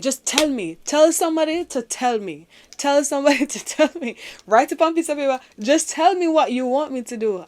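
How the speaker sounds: shouting at God in frustration, begging; retold yelling. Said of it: "I just started yelling".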